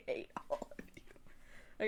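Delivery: slow, soft mock-bitter whisper; whispered mock grudge. Real, not acted. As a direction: breathe it out like a sulky joke. Mock grumble after teasing.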